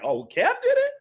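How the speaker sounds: high, surprised mock voice of someone impressed. Imitating an outsider who is impressed Kev did it. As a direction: squeak it out like an amazed outsider.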